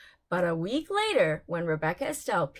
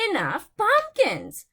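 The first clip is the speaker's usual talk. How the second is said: fed-up exclamation; 8 semitones higher, 6 dB louder than usual. A narrator's reading. Fed up with pumpkins.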